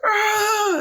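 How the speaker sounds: very high, strained mock wail; imitated labor cry. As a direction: let out her pushing cry. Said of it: "and then she's like".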